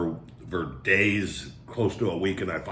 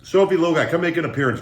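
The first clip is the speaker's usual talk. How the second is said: calling dogs; 6 semitones higher, 5 dB louder, faster than usual. Real, not acted.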